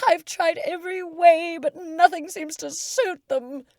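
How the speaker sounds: a wail of despair; a high, wailing sob; sobbing pigeon. Acted. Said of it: "added with a kind of a sob".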